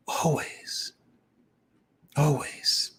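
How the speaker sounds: breathy whisper of always; hushed emphatic aside. Real, not acted.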